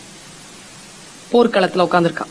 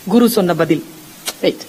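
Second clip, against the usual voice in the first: ordinary talk, nothing marked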